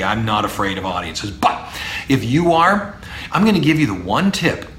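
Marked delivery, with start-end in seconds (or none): none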